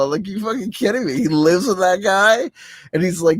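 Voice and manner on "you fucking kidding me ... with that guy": astonished. Retold or imitated. Retold shocked reaction.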